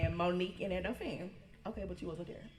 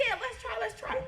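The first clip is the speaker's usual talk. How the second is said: eager self; 14 semitones higher than usual. Retold or imitated. Younger self quoted, eager.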